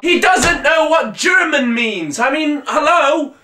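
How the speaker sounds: high, slow, disbelieving outburst; can't believe what he just heard; incredulous shout. Real, not acted. Vlog host reacting to a clip, shouting in disbelief.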